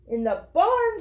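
high, astonished storybook exclamation; children's delighted shout, read by a narrator; excited shout. A narrator's reading. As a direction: echo it back as an excited shout. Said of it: "shouted the children".

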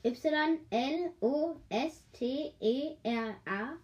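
each letter slow and apart; slowly spelling Y L O S T E R A. Shown as a demonstration. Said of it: "wie man das ausspricht".

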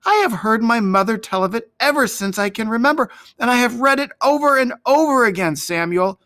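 put-on high, insistent young voice; hot boyish protest. A narrator's reading. David defends himself to the doubting shepherds, high hot insistence. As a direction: argue eagerly, pitched well up. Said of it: "replied the boy hotly".

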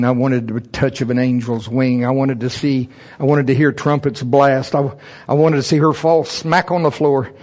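ordinary talk, nothing marked

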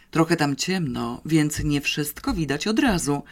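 plain reading voice

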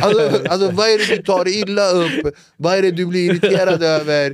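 almost shouting in disbelief; loud exasperation. Real, not acted. Comedian ranting about people who take offence, loud and incredulous.

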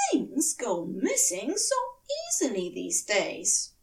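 a touch of prim Aziraphale; prissy and defensive, lightly voiced. A narrator's reading. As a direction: Aziraphale excusing himself to Crowley, primly. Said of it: "Aziraphale said primly".

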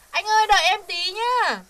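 calling out. Acted. Calling sweetly to her boyfriend.

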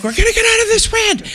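put-on father shouting reassurance to his kids; yelling over wind. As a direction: call it up high over the storm.

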